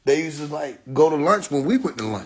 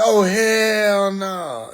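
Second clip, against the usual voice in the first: laughing disbelief; 5 semitones higher, 3 dB louder, much slower than usual. Real, not acted. Reacting in disbelief.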